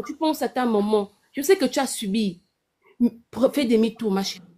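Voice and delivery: ordinary delivery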